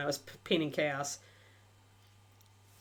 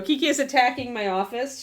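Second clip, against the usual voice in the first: ordinary talk, nothing marked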